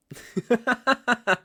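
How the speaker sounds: high cruel laugh; a gloating cackle; mocking laugh. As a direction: laugh down at her helplessness. Villain mocks her weakness.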